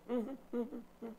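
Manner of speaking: high-pitched mock agreeable sister; sister's way of talking. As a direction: imitate her breezy chatter studded with mm-hmms. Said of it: "That's how she talks".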